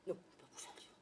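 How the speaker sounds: unvoiced whisper to herself. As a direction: whisper it, hungry and fed up. Talking to herself, hungry.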